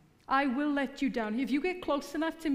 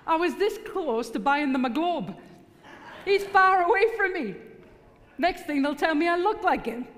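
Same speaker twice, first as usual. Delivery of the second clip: excited and loud; 7 semitones higher, 6 dB louder than usual. Real, not acted. Excited story about her accent.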